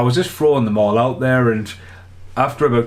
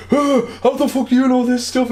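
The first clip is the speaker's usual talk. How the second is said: much higher, stunned mock voice; Carl's shocked disbelief; 12 semitones higher than usual. A guy imitating his friend's disbelief at guessed movie answers, high and stunned. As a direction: squeak it out in disbelief. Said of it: "but he was just like".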